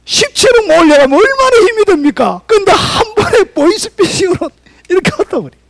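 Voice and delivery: crying out high and loud, voice straining; a fervent preacher's wailing climax; impassioned preaching. Acted. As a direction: preach it like a lament over lost toil. Preaching at full force.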